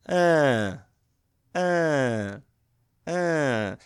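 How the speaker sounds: mimicking a baby's steady "uh".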